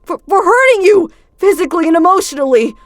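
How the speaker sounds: high, loud, breaking confession; desperate apology. Acted. Felix confesses to Luca, high and desperate. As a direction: pour out the guilt.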